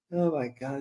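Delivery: ordinary talk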